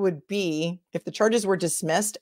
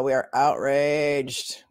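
mock outrage; 4 semitones lower, much slower than usual.

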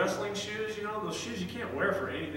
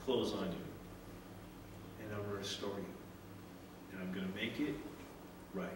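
tender father; 8 semitones lower, 10 dB quieter than usual. Retold or imitated. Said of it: "He says".

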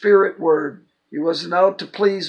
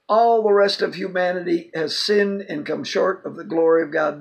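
ordinary talk

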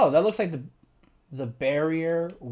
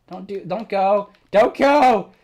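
loud, high, rising pleading; desperate plea; 4 semitones higher, 11 dB louder, slower than usual. Real, not acted. Player pleading with a friend not to go. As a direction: cry out for them to stay. Character leaves forever.

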